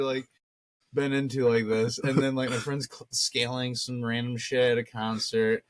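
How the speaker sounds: usual speaking voice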